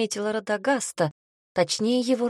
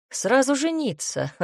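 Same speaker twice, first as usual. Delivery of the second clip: smirking; 6 semitones higher, 4 dB louder than usual. Acted. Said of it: "хмыкнул папа с ухмылкой".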